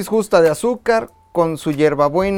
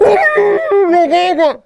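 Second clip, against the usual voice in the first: much higher, loud "Uy, la guagua"; excited yelp; 12 semitones higher, 4 dB louder, much slower than usual. Real, not acted. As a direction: squeal at the surprise. Excited reaction to gift.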